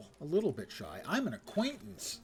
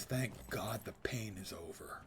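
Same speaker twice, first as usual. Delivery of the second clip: low, soft sigh of relief; weary relief; 5 semitones lower, 7 dB quieter than usual. Acted. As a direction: let it out almost in a whisper. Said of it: "All I get is".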